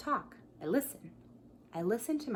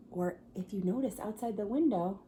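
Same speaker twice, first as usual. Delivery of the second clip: ordinary delivery, nothing marked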